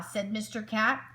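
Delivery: ordinary delivery, nothing marked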